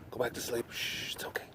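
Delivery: soft soothing whisper. Real, not acted. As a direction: hush him back to sleep. Soothing the cat.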